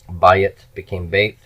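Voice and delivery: bayit then bait, each said slowly and clearly; slow teacher demo of bayit and bait; slow pronunciation demo. Shown as a demonstration. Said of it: "diphthongs contract".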